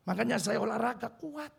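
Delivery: ordinary talk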